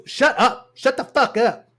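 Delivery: high, exaggerated irritated snap; mock a movie star's tantrum; acted angry outburst. [name] telling the woman off, acted irritation.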